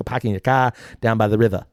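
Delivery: put-on playful Boston accent, dropped R's; mock Boston accent. Teasing Boston while co-host laughs, mock Boston accent.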